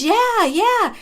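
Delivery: high, emphatic double yes; imitating eager agreement. Girl confirms she saw him directly, emphatic agreement. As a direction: act out a witness chiming in. Girl backs up the disputed account.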